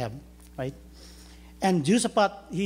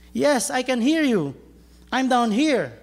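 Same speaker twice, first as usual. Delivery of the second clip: shouting back up; 6 semitones higher, 4 dB louder than usual. Acted. Jack shouts up the cliff.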